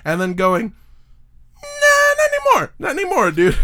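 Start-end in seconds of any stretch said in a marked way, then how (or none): 1.6-3.6 high, squealed mock protest; hammed-up high-pitched objection; mock refusal. Acting cult members rejecting their prophet.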